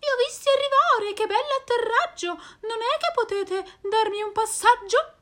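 molto acuta, veloce e più forte; vocina di rana. Acted. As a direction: chiedi il passaggio da ranocchia. La rana chiede un passaggio. (rana che chiede un passaggio, vocina acuta)

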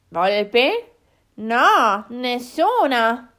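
loud boar voices, drawn out at the end; loud, slow animal exclamation; exclaiming wild boars. Acted. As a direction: blurt it out like startled boars. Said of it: "esclamarono i cinghiali".

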